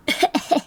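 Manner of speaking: airy little giggle at her own voice; breathless giggle. Real, not acted. Laughing at own voice.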